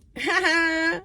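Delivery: high-pitched real laugh; amused laugh. Real, not acted. Vlogger tickled by a fitting fortune, high amused laugh. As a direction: laugh in delighted recognition.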